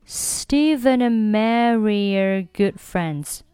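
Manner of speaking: slow, drawn-out model sentence, words linked; teacher's slow-motion connected speech; slow linked reading. Shown as a demonstration. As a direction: stretch the sentence out for learners to follow. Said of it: "我们连起来读一下".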